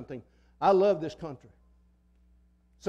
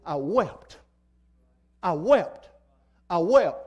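emphatic repetition; 6 dB louder, slower than usual. Real, not acted. Grief over toppled statue.

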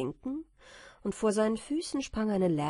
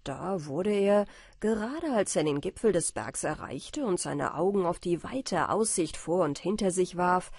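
plain reading voice

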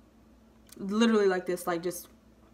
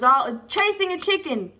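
ordinary talk, nothing marked

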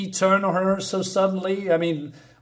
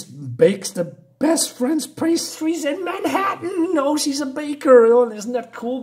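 mock gushing; 9 semitones higher than usual. Mocking gushing about baker.

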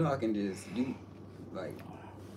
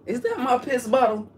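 startled; 13 semitones higher, 7 dB louder than usual. Real, not acted. Sudden alarmed discovery.

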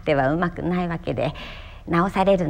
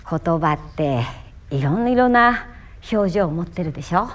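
ordinary delivery

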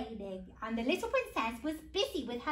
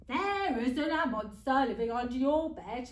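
louder storybook dad voice; lightly put-on father voice; reassuring dad; 5 dB louder than usual. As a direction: play a dad dismissing a monster. Dad reassures about the monster.

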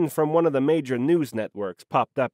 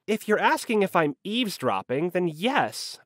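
usual speaking voice

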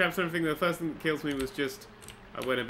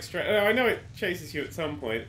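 usual speaking voice

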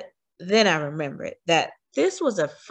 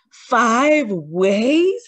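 shocked, louder, rising question; gasped; 5 semitones higher, 7 dB louder than usual. A narrator's reading. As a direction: gasp it in disbelief. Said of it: "Helen gasped".

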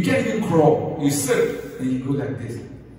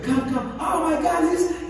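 high squealing cheer; thrilled parent. Retold or imitated. Parent cheering the baby's first steps, very high and thrilled.

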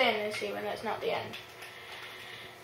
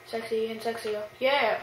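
usual speaking voice

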